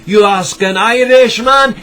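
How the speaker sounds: put-on very loud, high Scottish accent; booming mock Scottish brogue. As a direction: belt it out like a hammed-up Scot. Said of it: "I said the Irishman with a Scottish voice".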